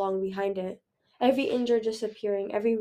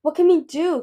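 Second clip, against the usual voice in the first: raised, urgent question to a friend; worried, higher and louder; anxious question; 7 semitones higher, 9 dB louder than usual. A narrator's reading. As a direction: ask anxiously for help. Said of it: "Kibbley asked Moon".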